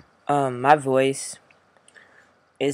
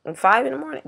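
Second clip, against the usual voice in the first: incredulous; 12 semitones higher, 5 dB louder, much faster than usual. Real, not acted. Outraged at early shift.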